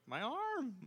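high mock cry of pain; pained yell. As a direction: yelp like the arm just snapped. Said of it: "you're like".

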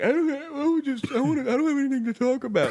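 high, whiny mock voice of a shy guest; mimicking the guest. Mocking a shy guest who has nothing to say, high whiny voice. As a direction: squeak it out like a nervous guest. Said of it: "he was like".

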